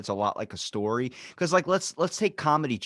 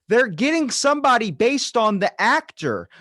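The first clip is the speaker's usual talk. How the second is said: raised high and loud, incredulous; exasperated retort; 10 semitones higher, 6 dB louder than usual. Retold or imitated. To critics of the casting, incredulous raised voice. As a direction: fire it back like it's obvious. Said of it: "I'm like".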